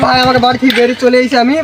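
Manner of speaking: ordinary talk, nothing marked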